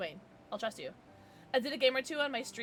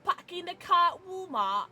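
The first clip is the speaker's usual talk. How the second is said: imitating mom's northern accent, pitched up; 7 semitones higher, 4 dB louder than usual. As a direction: put on a northern accent with dropped r's in "car". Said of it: "My mom still has a northern accent".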